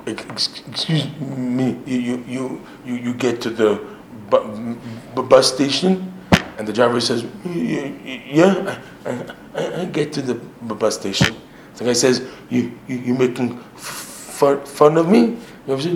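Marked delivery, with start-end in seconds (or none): 0.1-6.1 soft, halting stutter; hesitant joke-character stutter; stuttering passenger. Acted. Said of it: "I talk like, like this". 7.4-11.3 soft, badly blocked stutter; halting joke-character stutter; stuttering bus driver. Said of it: "I talk like, like this". 12.5-15.4 soft offended stammer, rising at the end; halting stutter on first sounds; stuttering passenger. Acted. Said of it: "I talk like, like this".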